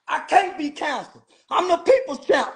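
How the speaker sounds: normal talking voice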